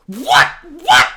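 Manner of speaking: loud, high, stabbed-out shock; escalating disbelief. Retold or imitated. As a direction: yelp it in utter bafflement. Acts out escalating viewer disbelief.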